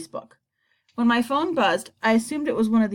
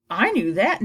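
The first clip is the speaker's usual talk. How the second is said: usual speaking voice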